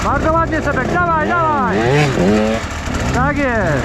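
high, eager cheer; excited cheering. Real, not acted. Cheering rider on from trail.

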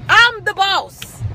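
each word slow and spaced; slow, firm declaration; slow emphatic. Acted. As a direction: drag out I'm the boss deliberately. Repeating the boss line, slowed.

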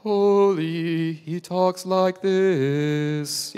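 slow mock-solemn holy voice, lightly done; stern holy Jesus. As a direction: drag it out like a stern, distant Jesus. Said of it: "read Jesus as this stern".

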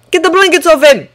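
high, loud urgent yell. A narrator's reading. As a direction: shout the order over the panic. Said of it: "he yelled".

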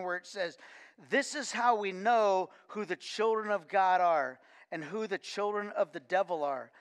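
usual speaking voice

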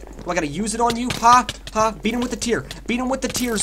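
usual speaking voice

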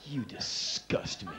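slow, low, drawn-out disgust; acted loathing, said slowly; disgusted crew member. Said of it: "They're looking at me like".

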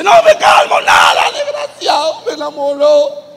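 hysterical rage. Acted. Ruth shrieking at Booz, refusing to calm down. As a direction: shriek it out in comic outrage.

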